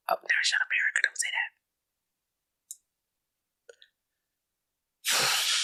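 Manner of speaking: soft unvoiced whisper to someone off mic. Real, not acted. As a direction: hush it under your breath.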